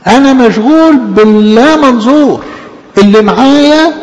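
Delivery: emphatic. Real, not acted. Preacher builds on God's power.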